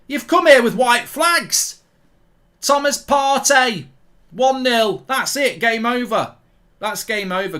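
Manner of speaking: real anger at the useless defence; furious rant. Real, not acted. Berating Leicester players for conceding, furious shouting. Ranting at Leicester after goal.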